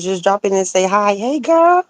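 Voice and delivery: ordinary talk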